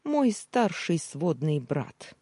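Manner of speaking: ordinary delivery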